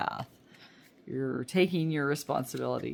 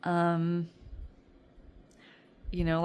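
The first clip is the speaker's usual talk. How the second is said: ordinary talk, nothing marked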